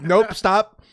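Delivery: high, loud mock cutting-off; loud refusal. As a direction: shout him down, half joking. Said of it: "I'm like".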